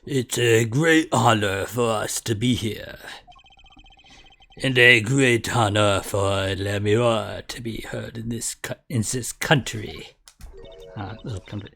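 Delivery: put-on low, gravelly French accent. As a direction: growl it out like a French diplomat. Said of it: "French accent that's also kinda gravelly".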